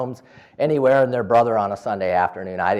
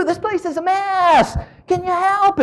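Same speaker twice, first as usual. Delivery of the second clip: high, slow, theatrical fuss over the mess; mock dismay; 5 dB louder than usual. Caregiver coaxes Mary Sue to the dishes, high slow mock fuss. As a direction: play it up as a cheerful plea for help. Caregiver asks resident for help.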